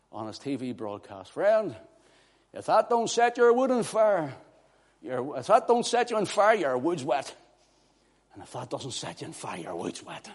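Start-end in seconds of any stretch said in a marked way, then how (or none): 1.3-7.3 high-pitched TV preacher impression, settling at the end; Pastor Jennings. Preacher quoting his friend's TV broadcast, raised preacher impression. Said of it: "as my friend Pastor Jennings would say".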